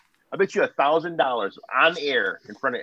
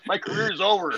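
whiny fired coach; 9 semitones higher than usual. Retold or imitated. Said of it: "voicing fired coach".

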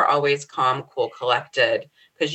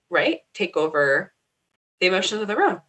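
ordinary delivery

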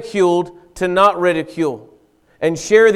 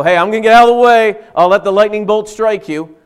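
quick, louder, higher mock gloat; smug vindictive; 5 semitones higher, 7 dB louder than usual. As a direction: play the smug bystander who can't wait for judgment.

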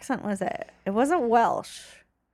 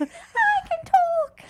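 put-on much higher, very slow childlike voice; little girl voice; much slower than usual. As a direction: stretch it out like a small child. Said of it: "I was doing that voice to myself".

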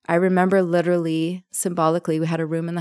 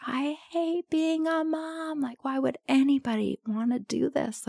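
retold scream; 8 semitones higher, 4 dB quieter than usual. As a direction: imitate screaming in despair without the volume. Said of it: "literally screaming at the top of my lungs".

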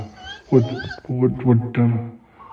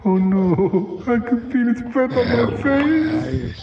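higher, pleading and scared as it comes close; frightened pleading; 5 semitones higher than usual. Acted. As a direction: beg the beast to pass you by. Pleading with approaching monster.

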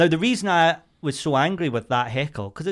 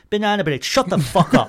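angry retort; 8 semitones higher, 7 dB louder than usual. Retold or imitated. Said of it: "I probably did it with more venom".

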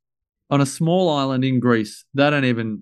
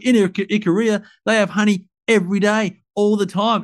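put-on childish arguing; 6 semitones higher than usual. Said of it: "I was being a little kid now".

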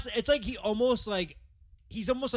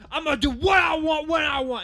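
high, loud imitation of a shouted answer; defiant mock shout at the reporter; mock angry shout; 12 semitones higher, 8 dB louder than usual. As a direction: yell it like a defiant star. Kyrie snapping at reporter.

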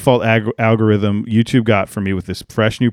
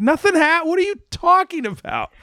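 much higher, exaggerated incredulous whine; mock disbelief. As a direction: ham up the baffled viewer. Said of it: "And I'm like".

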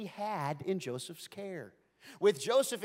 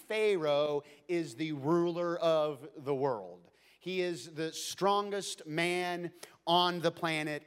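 slow emphasis; slower than usual. Acted. Slowed emphasis.

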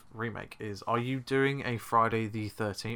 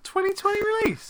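high disbelieving question; incredulous; 4 dB louder than usual. Real, not acted. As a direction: ask it in total disbelief. Disbelieving repeat.

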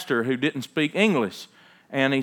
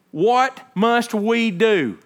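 slow pleading question; 8 semitones higher, 5 dB louder, much slower than usual. Acted. Crowd's plea voiced in sermon.

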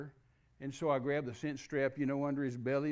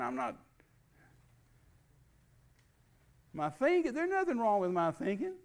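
indignant protest; 7 semitones higher than usual. Retold or imitated. Protesting to God he is fine.

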